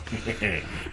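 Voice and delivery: quiet, sly chuckle; soft menacing chuckle; sly low chuckle. Acted. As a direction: laugh softly with menace.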